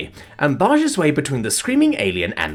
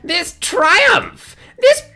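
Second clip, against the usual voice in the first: much higher, louder, grand proclaiming; dramatic alien rapture; 6 dB louder, slower than usual. A narrator's reading. Alien dancing with boba tea, proclaiming in ecstatic high rapture. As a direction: sing out praise mid-dance like a diva. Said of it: "starts doing a dramatic dance".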